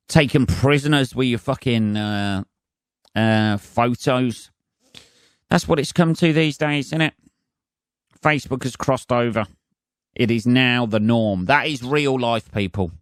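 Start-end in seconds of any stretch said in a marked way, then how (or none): none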